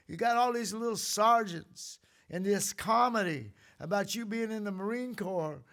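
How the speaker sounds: normal talking voice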